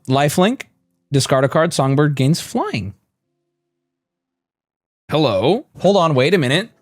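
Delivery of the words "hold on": ordinary talk, nothing marked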